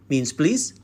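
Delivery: normal talking voice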